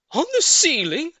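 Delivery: very high, loud squeak of disbelief; small monkey crying out. A narrator's reading. Small monkey protesting to its dad, high squeal. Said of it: "cried one of the small monkeys".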